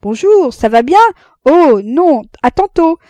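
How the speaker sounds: high, loud greetings across the street; calling from afar. A narrator's reading. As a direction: call out to friends far off. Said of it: "On se criait de loin". (crié de loin, fort et aigu)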